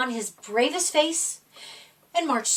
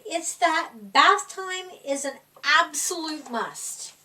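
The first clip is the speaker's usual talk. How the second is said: ordinary delivery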